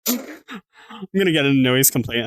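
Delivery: slow, drawn-out mock dramatic repeat. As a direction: stretch the line out for comic effect. Repeats the line, slower.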